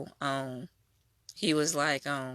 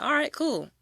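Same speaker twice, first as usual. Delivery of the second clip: much higher, light mock "all right, cool"; breezy agreement; 12 semitones higher than usual. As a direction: toss off an easy-going yes in a high voice. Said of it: "she was like".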